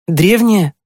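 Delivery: put-on high boyish question; checking eagerly. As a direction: ask for detail in a high, interested voice. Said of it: "уточнил он". (уточнить высоким мальчишеским голосом)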